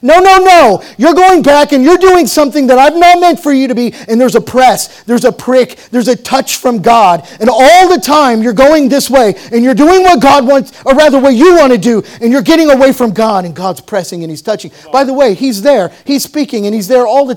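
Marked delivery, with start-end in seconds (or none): 0.0-3.9 high, loud pleading rebuke; God's insistent no. Acted. God calling a wandering believer home, loud pleading rebuke. 7.4-13.4 loud, rising preacher's cry; full-force preaching. Acted. Preacher urging his listeners, preaching at full force.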